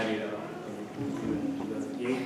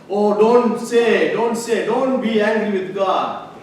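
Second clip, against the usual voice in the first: loud, slow, high warning voice of scolding people; drawn-out loud warning, imitating busybodies; mock scolding; 7 semitones higher, 12 dB louder, slower than usual. Preacher mimics people warning against anger at God, loudly and slowly.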